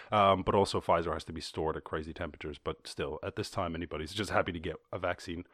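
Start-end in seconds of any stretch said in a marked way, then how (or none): none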